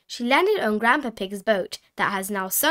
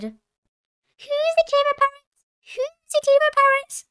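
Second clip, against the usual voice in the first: Polly Parrot; 4 dB louder than usual. A narrator's reading. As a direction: squawk the phrase like a parrot. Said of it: "Polly Parrot opened her beak and said".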